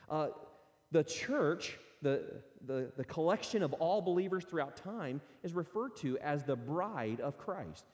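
usual speaking voice

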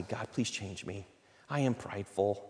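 soft, slow confessional prayer; hushed plea to God; humble prayer. Acted. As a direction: pray it quietly, bowed.

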